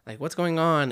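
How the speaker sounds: high, put-on complaining wife; imitated wife. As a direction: imitate a baffled wife, pitched well up. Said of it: "And she's just saying".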